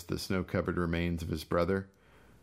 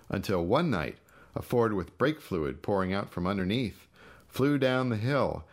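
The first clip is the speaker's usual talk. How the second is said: plain reading voice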